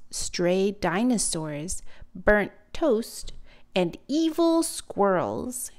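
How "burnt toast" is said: plain reading voice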